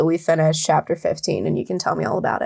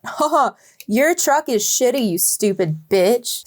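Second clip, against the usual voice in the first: high, mocking laugh into an insult; hammed-up gloating mockery; mocking taunt; 5 semitones higher than usual. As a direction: jeer it in a put-on high voice, then draw out the end. Mock villain laugh and taunt.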